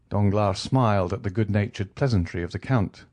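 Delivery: usual speaking voice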